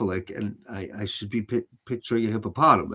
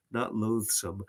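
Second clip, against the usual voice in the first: ordinary delivery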